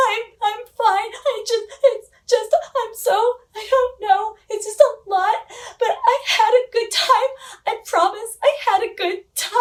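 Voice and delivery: reassuring Josh while blubbering, in a high teary voice; teary squeak. Retold or imitated. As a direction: imitate herself crying through reassurances. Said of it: "so that I can blubber in private".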